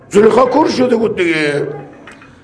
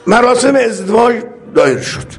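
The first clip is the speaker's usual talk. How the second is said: ordinary talk, nothing marked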